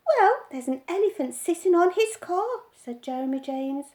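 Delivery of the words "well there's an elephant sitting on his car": put-on high, louder little boy; a small boy reporting eagerly; boy telling his mother, high and louder; child's voice. A narrator's reading.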